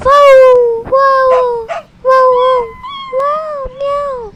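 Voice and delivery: high, loud yowling cat; the pet cat wails beside the lonely girl; cat meowing. Acted. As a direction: meow like a wailing cat.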